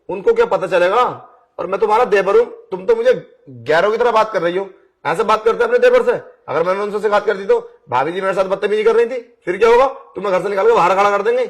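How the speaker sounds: angry rising rant, a touch fast at the end; heated threat. Acted. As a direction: push the threat back at her, heated. Devar turns the threat on bhabhi.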